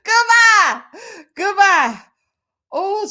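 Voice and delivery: high, loud laughing goodbye. Real, not acted. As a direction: shriek goodbye through laughter. Laughing at own quote.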